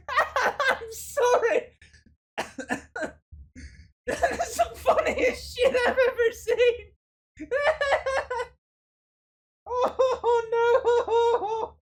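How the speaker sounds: high, loud words through helpless laughter; laughing hard. Real, not acted. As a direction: crack up so hard you can barely speak. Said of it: "my sides hurt".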